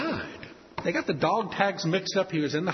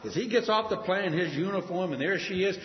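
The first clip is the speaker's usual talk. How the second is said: ordinary talk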